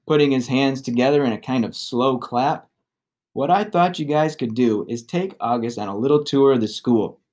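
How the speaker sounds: ordinary delivery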